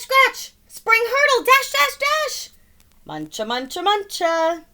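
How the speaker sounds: usual speaking voice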